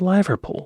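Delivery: "Liverpool" with "Liver" rhyming with "diver"; long-I pronunciation. Shown as a demonstration. Viewer asks about the city's name, "Liverpool" as LIE-ver-pool. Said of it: "Why isn't it called".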